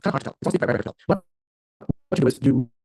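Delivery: choppy, dropping-out call audio; speech cutting in and out; glitching audio. Real, not acted. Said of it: "Your voice is glitching".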